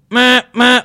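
mimicking a polite warning honk at the car ahead; little sissy horn beep. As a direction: chirp it like a tiny car horn. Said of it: "a little meep, meep".

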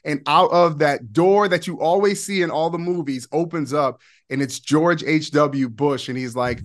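usual speaking voice

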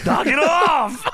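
shouted, slow and high; loud slow shout. Real, not acted. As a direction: yell it out slowly, laughing.

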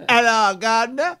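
bad London accent. Co-host copies the London line, hopeless accent. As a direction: badly mimic a London accent. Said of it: "Okay, it's hopeless".

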